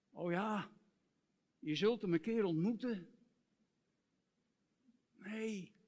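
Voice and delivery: ordinary talk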